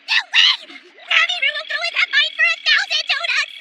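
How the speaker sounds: scared protest. Acted. Refusing to enter haunted mine.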